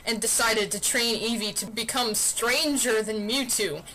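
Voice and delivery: ordinary delivery, nothing marked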